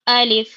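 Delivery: الف said short and clipped; الف, crisp and brief; quick alif. Shown as a demonstration. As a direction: snap out الف quickly with no stretch. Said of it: "الف کو ایک دم جلدی پڑھنا ہے".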